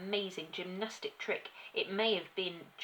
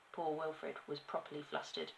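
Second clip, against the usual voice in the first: plain reading voice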